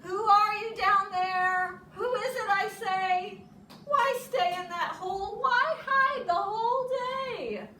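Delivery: high, loud sing-song calling. A narrator's reading. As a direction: call down into the hole. Animals call into the hole.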